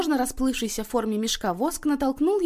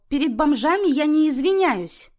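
much louder and higher, scornful refusal; mother shouting down her daughter's demand; indignant shout; 8 semitones higher, 8 dB louder than usual. Acted. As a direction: hurl it back in outrage.

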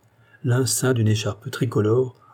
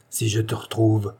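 ordinary delivery

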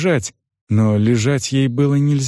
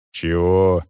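low, grudging peasant man; gruffly unimpressed, low-pitched; displeased; 6 semitones lower than usual. A narrator's reading. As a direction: ask it low, annoyed at being disturbed. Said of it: "недовольно спросил мужик".

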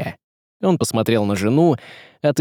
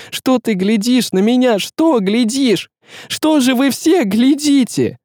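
high, frenzied shrieking; hysterical rage; 13 semitones higher, 3 dB louder than usual. Acted. Tsaritsa raging at the staring crowd, hysterical. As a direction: lash out at everyone staring, drawn out and high.

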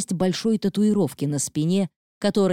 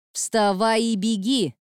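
taunting; 6 semitones higher, slower than usual. A narrator's reading. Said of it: "провоцировала Мелиса Ева".

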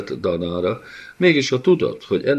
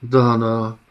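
plain reading voice

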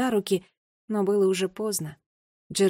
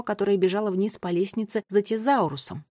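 usual speaking voice

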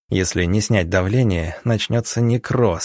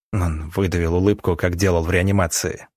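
ordinary delivery

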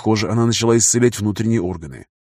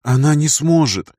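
usual speaking voice

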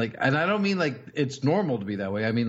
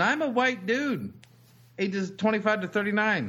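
quoting Homer; 8 semitones higher than usual. Acted. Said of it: "That's a Simpsons joke".

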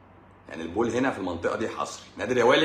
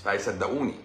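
ordinary delivery

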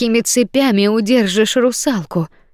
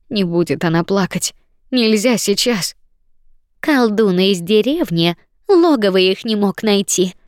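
plain reading voice

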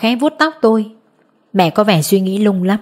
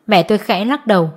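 ordinary delivery

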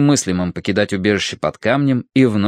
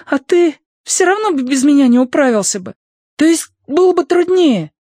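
put-on young boy voice; 4 dB louder than usual. A narrator's reading. Young boy figure Rizhik.

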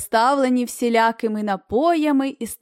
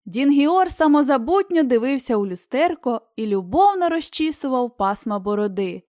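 ordinary delivery

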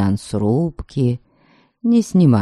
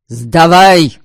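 loud shouted command; shouting; 5 semitones higher, 8 dB louder than usual. Acted. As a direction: bark the order across the deck. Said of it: "крикнул ему Алексей". (крик)

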